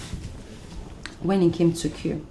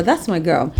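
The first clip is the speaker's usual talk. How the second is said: ordinary talk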